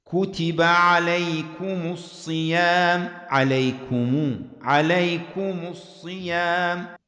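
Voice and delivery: slow chant of عليكم as alaykumu as-siyam; damma on the mim. Shown as a demonstration. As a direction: recite عليكم with the mim voiced as mu. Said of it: "مرات تضم". (ترتيل عليكمُ الصيام بضم الميم)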